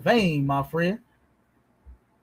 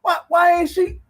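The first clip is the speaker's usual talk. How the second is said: ordinary talk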